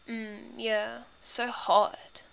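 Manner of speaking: soft, dazed murmur. A narrator's reading. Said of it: "Frank's murmuring".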